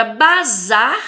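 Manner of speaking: "bazar" with a loud, long final ZAR; emphatic ZAR in "bazar"; tonic syllable stressed. Shown as a demonstration. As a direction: hit the last syllable of "bazar". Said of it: "fala com mais intensidade".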